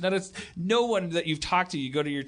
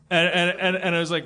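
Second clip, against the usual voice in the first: ordinary talk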